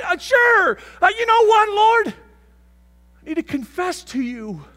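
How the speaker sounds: high, loud shout of guilt; shouting confessor. Acted. Confessor shouting to the Lord, loud and high. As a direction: yell it out, owning all the blame. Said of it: "a big red dot on yourself that says".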